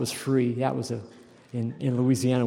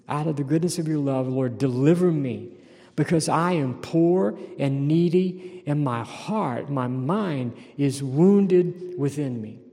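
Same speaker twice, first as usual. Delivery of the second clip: slow, heavy psalm reading; preacher slowly reading a lament; solemn scripture reading; 2 semitones higher, 4 dB louder than usual. Acted. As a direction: dwell on each word.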